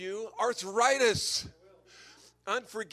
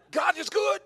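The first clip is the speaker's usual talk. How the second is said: high, loud laughing praise; joyful exclamation; 12 semitones higher, 8 dB louder than usual. Real, not acted. Preacher praising God to the congregation, loud and laughing. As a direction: burst out laughing with joy.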